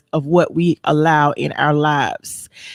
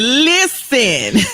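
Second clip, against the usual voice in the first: higher, drawn-out mama Listen; mama's listen; 6 semitones higher than usual. Retold or imitated. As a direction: stretch the word Listen like a mother warning. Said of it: "like your mama said".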